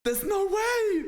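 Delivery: very high, drawn-out squeal; shrieked disbelief. Real, not acted. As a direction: squeal it slowly, utterly incredulous. Disbelief at the word heard again.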